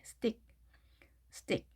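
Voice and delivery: 스틱 as a soft, short 스띡; light pronunciation. Shown as a demonstration. Teacher demonstrates the pulled-back vowel, light soft 스띡. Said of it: "가볍게".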